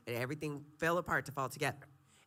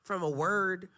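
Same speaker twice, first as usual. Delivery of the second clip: high, loud preaching; 5 semitones higher, 5 dB louder, slower than usual. Acted. Guest preaching to the host about correction. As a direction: lift it up like a preacher.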